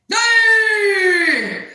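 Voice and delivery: long, high mimicked holler; loud boss yell. Annoying boss calling out every day, long yell. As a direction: stretch it into a piercing shout. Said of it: "he's loud".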